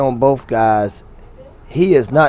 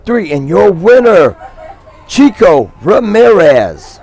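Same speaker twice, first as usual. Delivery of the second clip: louder, drawn-out ring announcer call; winner announcement; 3 semitones higher, 4 dB louder than usual. Real, not acted. Announcer declaring Chico Ramirez the winner, big slow call. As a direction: boom out the winner's name.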